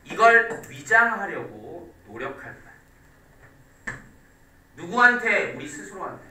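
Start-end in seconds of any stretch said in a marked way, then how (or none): none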